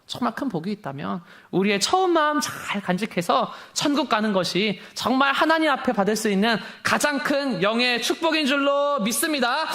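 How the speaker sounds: normal talking voice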